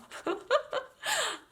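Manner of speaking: high, giddy laugh; delighted laugh. Real, not acted. Reader delighted by the wings card, delighted laugh. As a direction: burst into high-pitched laughter.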